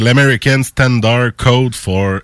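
English read with a Québécois accent; slow English in a southern Québec French accent. Real, not acted. Host reads out the acronym's English meaning, Québécois accent. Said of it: "Avec mon bel accent du sud de, du Québec".

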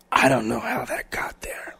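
slow, whining whisper; imitating her teary whisper; whimpering whisper. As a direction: whisper it like a guilty drunk. Drunk girlfriend whispering lame excuse.

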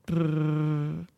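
lips buzzing in a long brrr; lip raspberry. Shown as a demonstration. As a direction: blow a loose lip trill. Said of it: "A bit like a raspberry, like this".

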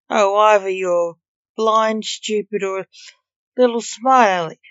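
loud, irritated telling-off; exasperated rebuke. A narrator's reading. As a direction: let the insults burst out loudly. Reaper exasperated at Jack's wrong guess.

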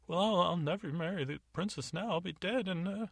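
the jailed captain pleading with Agatha, high and whiny; whimpering dolt of a guard. Acted. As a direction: play a blubbering coward begging the lady. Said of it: "he's kind of weeping in his cell".

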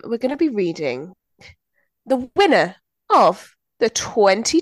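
slow, drawn-out prize announcement; grand announcement. Acted. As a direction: build it up like an awards reveal. Announcing next week's book, drawn out.

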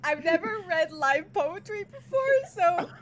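tipsy, nervous and laughing; nervous open mic poet. Real, not acted. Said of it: "I'm slightly drunk".

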